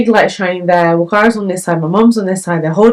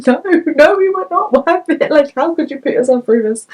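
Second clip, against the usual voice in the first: high, pleading protest; 10 semitones higher than usual. Retold or imitated. Retold protest to mum.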